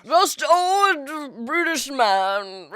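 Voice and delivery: put-on old British man, high and drawn out; hammed-up elderly British actor; very slow, quavering mock-British old man; Ian McKellen. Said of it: "an Ian McKellen accent".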